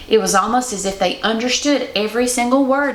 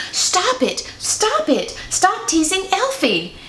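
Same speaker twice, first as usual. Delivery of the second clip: high, pitched-up yell to stop the teasing; 9 semitones higher, slower than usual. Acted. Little girl yelling at the mean elves, high pitched. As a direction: cry out to make them stop. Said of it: "I yelled really loudly".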